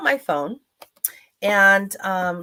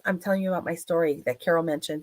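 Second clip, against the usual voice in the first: usual speaking voice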